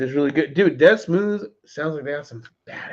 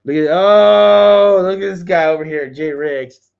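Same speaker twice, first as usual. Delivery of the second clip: excited greeting; 5 semitones higher, 9 dB louder, slower than usual. Real, not acted. Greeting a viewer joining live.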